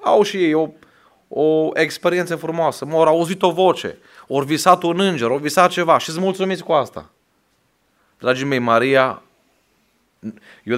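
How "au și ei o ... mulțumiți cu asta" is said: raised, higher preacher's voice; rising Pentecostal preaching; fervent preaching. Acted. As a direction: build up the fervour for the church. Preacher rising to full force.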